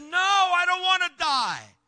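high, loud shout back; loud desperate answer; shouted reply. Retold or imitated. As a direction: call up out of the pipe. Trapped man answers shouted plea.

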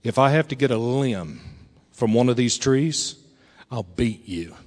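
low, quiet warning; threatening dad. Retold or imitated. Dad warns his big son, quietly menacing. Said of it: "But he said".

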